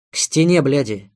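loud, furious order; angry command. A narrator's reading. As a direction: bark it out in anger. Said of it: "Он зря дал волю гневу".

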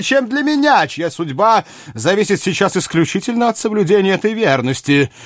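high, animated royal voice; theatrical high-voiced monarch bemoaning his fate; lamenting king. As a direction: plead your own plight in a high-pitched kingly voice. Narrator voices King Louis.

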